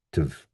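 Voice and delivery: "tiv" low and soft, reduced to a schwa; unstressed syllable. Shown as a demonstration. Teacher showing the last syllable, soft unstressed "tiv". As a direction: drop "tiv" to a weak, quiet "tuv".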